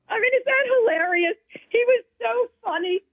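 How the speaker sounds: high, laughing exclamation; tickled, voice riding way up; amused, high-pitched delight. Real, not acted. Guest relives the Grammys joke for the host, high and amused.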